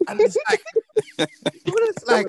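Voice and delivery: high laughing outburst; high, loud laughing; bursting laugh. Real, not acted. Laughing at daughter's retention.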